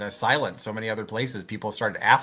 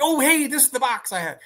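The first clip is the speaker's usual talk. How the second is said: imitating himself, much higher, pleased discovery; a bright pitched-up oh of rediscovery; mock surprise; 13 semitones higher than usual. Unpacking, he voices finding an old box, mock surprised. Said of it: "I'm like".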